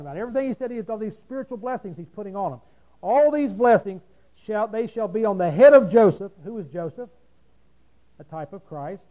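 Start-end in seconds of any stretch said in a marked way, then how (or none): 3.0-6.3 loud preacher's reading voice; booming pulpit reading; forceful scripture reading. Real, not acted. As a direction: declaim the verse loudly. Reads scripture at full force.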